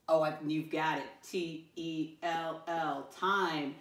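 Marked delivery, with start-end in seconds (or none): 1.2-3.8 slow, separated letters; spelling aloud. Shown as a demonstration. Teacher spells a word with pupils, slow spelling aloud. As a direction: say each letter slowly and distinctly.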